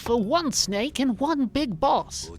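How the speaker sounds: drawn-out menacing game villain impression; Liquid. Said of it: "I like doing impersonations of Liquid".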